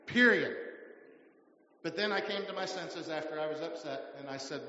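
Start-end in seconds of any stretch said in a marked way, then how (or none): 0.1-0.6 Period said sharp and louder; louder final word. Retold or imitated. Pastor retelling a man's rebuke, louder final word. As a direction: slam the last word down.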